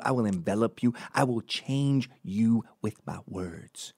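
mock preacher oratory; weird preacher talk. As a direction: imitate a preacher building an overblown address. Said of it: "this stupid, weird preacher talk".

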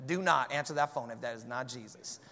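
low, soft, deadpan warning; mock instruction. Pastor tells the caller's owner off, low and deadpan. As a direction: tell them off dryly. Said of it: "You definitely tell that person".

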